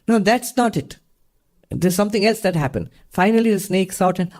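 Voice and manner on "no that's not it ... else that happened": doubting guru. Acted. Guru rejects snake's answer.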